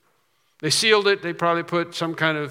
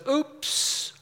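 mock oops; 12 semitones higher, 4 dB quieter than usual. Mock God dropping you.

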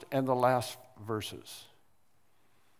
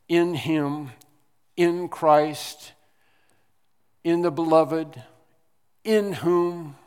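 every "in" punched louder and higher in "In him, in Christ, in the beloved, in whom"; stress on IN; 4 semitones higher, 7 dB louder, slower than usual. Shown as a demonstration. Preacher listing the verses, stressing each "in". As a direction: hammer each "in" in the list. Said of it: "but always the word "in".

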